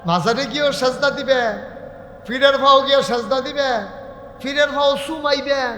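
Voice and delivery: high, loud preaching with stretched line ends; rebuking preacher. Acted. Preacher listing followers' misdeeds to the crowd, full-force rebuke. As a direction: hammer each charge out at the crowd.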